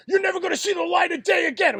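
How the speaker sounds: high, loud kidnapper's yell; mock threatening shout. Bandmates pretending to kidnap their friend, threatening shout. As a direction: shout it like a staged abduction.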